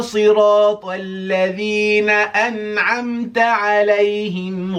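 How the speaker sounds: melodic chant without pausing for air; all in one breath. Shown as a demonstration. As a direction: recite it straight through on one breath. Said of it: "see if I can do the whole thing ... without taking a breath".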